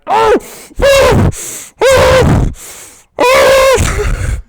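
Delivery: high, loud cries of pain easing slightly; repeated pained wails. Acted. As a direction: series of loud agonized wails. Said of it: "dies down a bit more, going".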